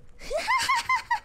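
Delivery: very high squealing laugh; delighted laughter. Real, not acted. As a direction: burst into giddy high giggles. Delighted by the raid.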